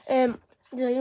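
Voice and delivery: ordinary talk, nothing marked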